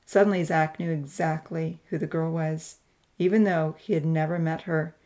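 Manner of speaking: ordinary delivery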